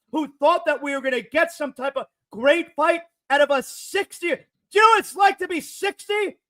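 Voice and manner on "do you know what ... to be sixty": shouted rhetorical question. Real, not acted. Rant on the Tyson fight.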